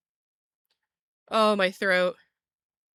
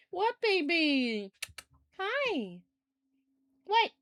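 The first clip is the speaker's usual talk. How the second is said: high, soft, slow cooing at a pet; sweet pet-talk voice; 8 semitones higher, 4 dB quieter, much slower than usual. Real, not acted. Cooing to her cat.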